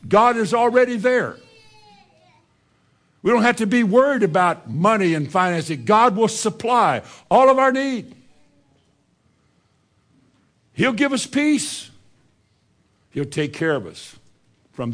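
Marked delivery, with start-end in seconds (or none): 0.1-11.9 loud, high preacher's call; preaching at full force. Acted. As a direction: lift the congregation up with power. Opening of the sermon, preaching hard.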